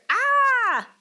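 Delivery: loud high jump scare; startled scream. Acted. Reader jumping out at the children with a shriek. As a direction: shriek as if grabbed. Creature grabs the boy.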